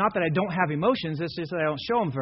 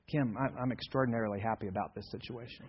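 deadpan; 4 semitones lower, 8 dB quieter than usual. Retold or imitated. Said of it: "when something really exciting happens".

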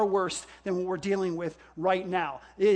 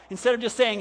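usual speaking voice

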